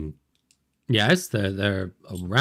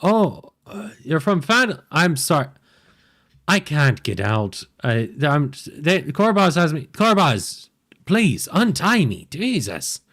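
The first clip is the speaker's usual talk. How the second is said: higher, startled and nervous gnome; 6 semitones higher than usual. Acted. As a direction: answer the knock like a jumpy little gnome. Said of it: "he goes".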